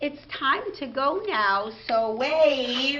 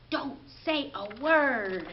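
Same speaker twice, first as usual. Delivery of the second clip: ordinary delivery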